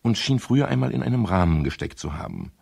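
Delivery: even, measured first-person narration; story narration. Acted. Detective narrating the search of the flat, calm and measured. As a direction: tell it like a detective's memoir.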